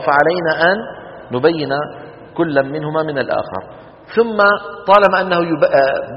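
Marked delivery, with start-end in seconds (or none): none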